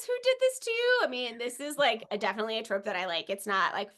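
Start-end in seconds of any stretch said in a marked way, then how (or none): 0.1-1.0 high, pleading quote of a worried heroine; high quoted line. Retold or imitated. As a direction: lift it up high and anxious. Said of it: "when she says".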